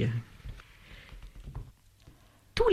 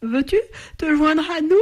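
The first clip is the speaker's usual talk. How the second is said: high, sustained yawny chorus voice; yawning bats; 8 semitones higher than usual. A narrator's reading. Sleepy bats invite the flamingo to join them, yawning. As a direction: invite through a put-on yawn, pitched up. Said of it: "Propose les chauves-souris en bâillant".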